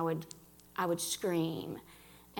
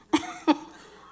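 a short high laugh; a brief bright laugh; 6 dB louder than usual. Real, not acted. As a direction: laugh it off lightly. Laughs at her own joke.

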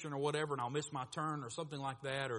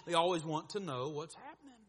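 usual speaking voice

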